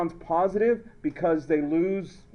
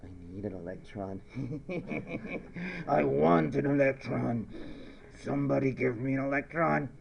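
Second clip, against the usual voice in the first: low, creepy craving voice with a laugh; menacing personified element, muted and low; desperate chlorine; 4 semitones lower, 6 dB quieter than usual. Acted. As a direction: beg like a sinister addict. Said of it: "chlorine in its natural state is going".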